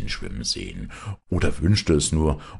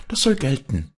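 higher, bright calling out to her father; eager cry; 11 semitones higher, 4 dB louder than usual. A narrator's reading. Daughter bursts in, calling to her father eagerly. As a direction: seize on his word with excitement. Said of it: "rief sie aus".

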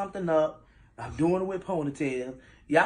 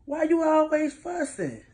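exasperated; 9 semitones higher, 5 dB louder than usual. Real, not acted. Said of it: "all I wanna know is".

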